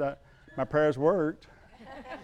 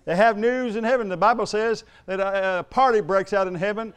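loud, ringing pulpit delivery; fiery sermon voice; preaching at full force; 5 semitones higher, 7 dB louder than usual. Acted. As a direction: preach it out to the whole congregation.

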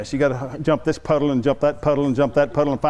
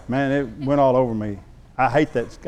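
ordinary talk, nothing marked

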